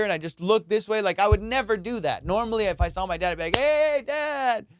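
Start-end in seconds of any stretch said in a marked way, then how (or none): 3.6-4.7 high, drawn-out call to dad; cheery greeting. Retold or imitated. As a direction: call out eagerly like a kid. Child calling out to dad.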